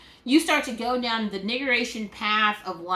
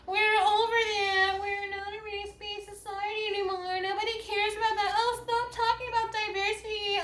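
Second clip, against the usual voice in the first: high sing-song mockery of the dismissive crowd; sarcastic high-pitched parody of people who say race is over; mocking whiny voice; 8 semitones higher than usual. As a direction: whine it back at them in a high, far-off mock.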